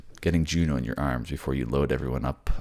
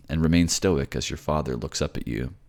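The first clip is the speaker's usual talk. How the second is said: usual speaking voice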